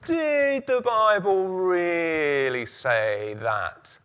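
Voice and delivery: slow, drawn-out insinuating question; sly tempter voice. Pretend. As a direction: tease out the doubt slowly. Voicing the devil's doubt.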